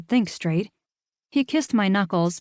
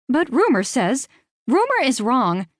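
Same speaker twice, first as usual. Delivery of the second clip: raised, louder cut-off; near shout; 6 semitones higher, 4 dB louder than usual. Acted. Narrator cutting off Rita's phone spiel, raised and firm. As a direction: cut her off sharply. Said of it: "I didn't mean to shout".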